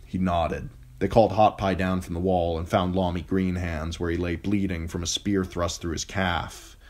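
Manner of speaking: ordinary delivery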